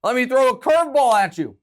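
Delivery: hammed-up gloating commentator; mock gleeful pundit. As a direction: ham up a smug analyst tossing a curveball. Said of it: "He was like".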